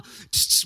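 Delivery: hissing tss of a spray bottle; spray bottle noise. Retold or imitated. Preacher mimics Windex spray for congregation, hissing spritz.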